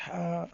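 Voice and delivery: a breathy ہا for the letter h; h sound demo. Shown as a demonstration. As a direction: voice ہا with a clear puff of air. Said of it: "ایچ ہمیشہ آواز نکالتا ہے".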